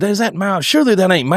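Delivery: high, exasperated retold outburst; mock angry cussing. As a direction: replay the irritation at a truck you think is your buddy's. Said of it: "Y'all was dog cussing me".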